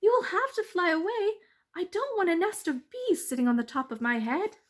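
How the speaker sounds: high startled mouse; a mouse calling up to the bees. Acted. Mouse addressing bees on his head, high.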